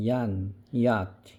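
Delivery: 얀 and 야트 drawn out slowly to show the Y sound; slow sound demo. Shown as a demonstration. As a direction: stretch 얀, 야트 so the child hears the Y. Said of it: "얘네는 앞에 뭐가 왔어요".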